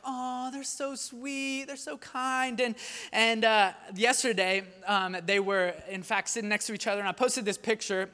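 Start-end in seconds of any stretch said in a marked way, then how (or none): 0.0-2.6 put-on soft, slow, higher cooing over the kids; cooing strangers. Shoppers admiring her kids, a soft slow coo. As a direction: coo like a charmed stranger in a store. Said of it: "they're always like".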